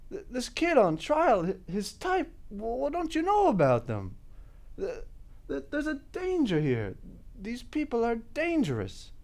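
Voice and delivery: quiet, stammering appeal; deflated, halting, fading voice; faltering plea. Acted. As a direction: lose steam and stumble over the words. Rant collapses as nobody listens.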